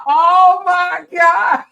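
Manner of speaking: loud, high, drawn-out exclamation; amused shock. Real, not acted. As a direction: cry out slowly in real amused disbelief. Cracking up at viewers' usernames.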